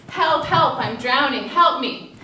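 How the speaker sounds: a drowning stranger calling from the river, half-hearted cry; mock cry for help. Telling a parable, imitates a drowning person's hammed-up call for help.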